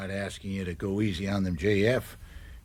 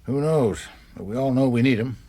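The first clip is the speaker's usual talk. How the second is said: loud, higher and fast; raised voice, rushing the second line; heated insistence; 5 semitones higher, 9 dB louder than usual. Acted. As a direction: hammer the point home, impatient. Adviser arguing about union votes.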